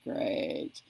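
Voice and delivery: deadpan, whispered sarcastic great. Retold or imitated. Fed up with the dropped call, whispered sarcasm. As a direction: drop it low and breathless, unimpressed. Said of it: "I'm like".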